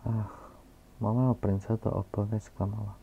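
ordinary talk, nothing marked

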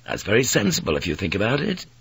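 plain reading voice

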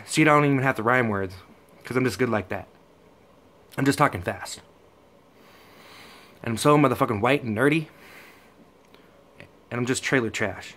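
usual speaking voice

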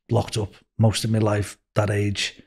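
ordinary talk

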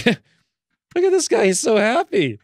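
high, bright delighted coo; delighted squeal. Real, not acted. Gamer gushing at her aged character, delighted. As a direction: gush over the little guy.

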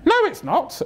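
very high-pitched mimicked woman; hammed-up high female voice; nosy woman neighbour. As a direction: squawk it like a prying neighbour. Woman neighbour contradicting him.

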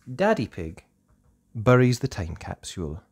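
plain reading voice

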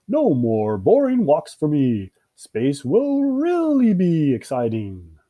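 slow, stretched-out excitement; cheering. Acted. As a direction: crow happily, swooping up on the big words. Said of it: "cheered Bill".

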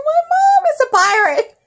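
high, fast giggly quote; laughing. Retold or imitated. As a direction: giggle through the line. Imagines boy's awkward confession.

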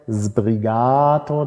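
very slow „Sbrigato“ with a long open A; drawn-out teaching model of „Sbrigato“; slow stress demo. Shown as a demonstration. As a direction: stretch the A in „Sbrigato“ out. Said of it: "das A wird leicht gelenkt".

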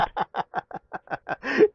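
a short high giggle; stifled laugh. Real, not acted. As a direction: snicker after the call drops. Call blocked, caller laughs.

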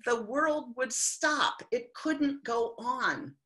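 usual speaking voice

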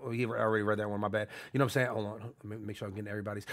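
ordinary talk, nothing marked

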